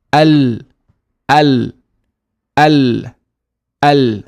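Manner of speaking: AL loud, the clear l held on; tongue-up lam. Shown as a demonstration. Teacher telling learners to touch the palate, held l. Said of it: "make sure your tongue goes up".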